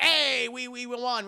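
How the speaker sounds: loud, high triumphant "hey"; acted victory cheer. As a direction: celebrate with a hammed-up holler. Acts victory celebration.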